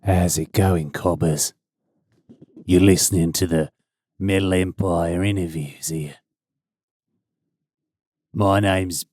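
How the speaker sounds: low, lightly put-on Australian host voice; a hint of Australian accent for the intro; mock Australian. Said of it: "it's an Australian name".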